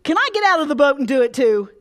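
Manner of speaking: loud, fast, carefree call; hammed-up thrill, like a kid at a fair; mock excited. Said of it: "would you look at him and say".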